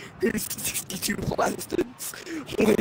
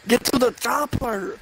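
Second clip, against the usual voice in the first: loud movie action hero command; action hero bark; 5 semitones higher, 5 dB louder than usual. Pretend. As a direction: bark it like a muscle-bound action star.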